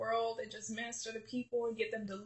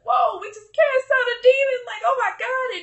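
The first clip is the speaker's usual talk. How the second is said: much higher, much louder squeal of joy; excited disciples; 13 semitones higher, 13 dB louder than usual. Retold or imitated. Disciples celebrating a cast-out demon, high excited cry. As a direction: burst out like new believers who just cast out a demon. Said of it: "They were excited and dancing".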